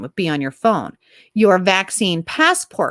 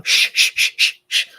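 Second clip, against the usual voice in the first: quick string of shushes; shushing. Pretend. Cutting off the blunt remark, shushing. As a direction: shush repeatedly, all hiss.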